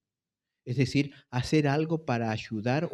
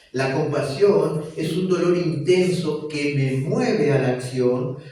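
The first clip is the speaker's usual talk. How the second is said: fervent preaching; 2 semitones higher, 5 dB louder than usual. Acted. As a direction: drive each phrase home to the congregation. Preaching at full force. (predicación fervorosa y lenta)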